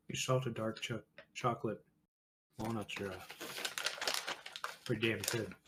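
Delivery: talking while chewing nuts; words through a crunchy mouthful; crunching nuts. Real, not acted. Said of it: "So you can hear me crunch".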